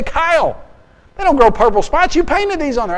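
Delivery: high, loud outraged protest; indignant buddy. Retold or imitated. Buddy calling out Kyle over the painted cows, high and loud. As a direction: catch the cheat and call him out.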